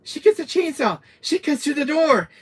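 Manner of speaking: high, loud, excited retelling; high-pitched loud alarm. Real, not acted. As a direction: blurt it out in horror. Telling terror scene.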